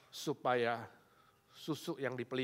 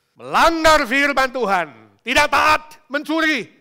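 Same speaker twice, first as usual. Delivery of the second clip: high, loud shouted charges; drawn-out, emphatic pulpit shout; full-force preaching; 12 semitones higher, 19 dB louder than usual. Real, not acted. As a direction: thunder out each offence to the congregation.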